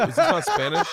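high, loud startled question; hammed-up realization; mock shock. Realizing the class is Russian, in mock shock.

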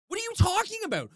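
fast, high-pitched disbelief; incredulous outburst. Pretend. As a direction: fire back at the critics, exasperated. Outburst at critics' imagined objection.

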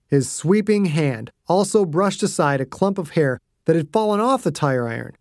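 ordinary delivery, nothing marked

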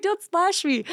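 high, playful mock complaint; coy splash protest; flirty protest. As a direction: pretend to scold him while flirting. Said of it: "I'm like".

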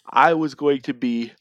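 words stretched through a yawn. Real, not acted. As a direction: say it mid-yawn. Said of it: "Sorry I keep yawning".

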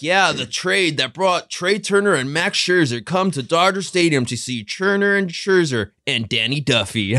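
slow, drawn-out promo announcer parody; mock promo voice. Host imitates the Dodger trade promo, in slow mock-announcer hype. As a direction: pitch it up and stretch out the plug. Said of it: "in the promo, they're like".

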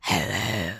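low, soft demon voice. Pretend. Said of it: "Bringing out my demon voice".

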